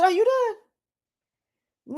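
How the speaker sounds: drawn out very slowly and pitched high; mock unbothered question. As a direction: stretch it out slowly, like you are bored of them. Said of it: "I said".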